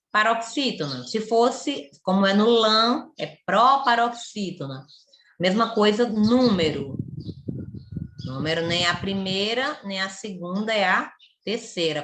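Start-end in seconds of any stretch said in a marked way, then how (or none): none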